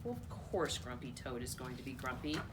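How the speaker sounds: ordinary delivery, nothing marked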